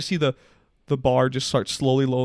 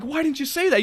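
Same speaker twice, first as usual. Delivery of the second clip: exasperated retort; 12 semitones higher than usual. Retold or imitated. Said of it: "I'm like".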